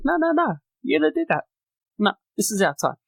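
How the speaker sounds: high-pitched mock brush-off; playful high mock voice waving off challengers; mock voice of the champions. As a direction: squeak it out like a dismissive champ. Banks and Bayley voiced brushing off.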